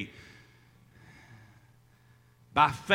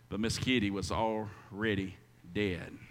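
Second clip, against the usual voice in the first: much softer and lower, letting it sink in; hushed; 10 semitones lower, 9 dB quieter than usual. Acted. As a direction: drop the voice to almost nothing. Hushed end of tragic story.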